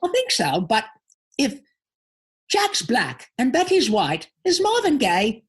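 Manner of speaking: high, manic cartoon-mouse voice; zany and high, swooping up on the punchline; Pinky. Actor answers the host's pondering question as Pinky, high cartoon voice. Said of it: "take us out with a, a bit of Pinky".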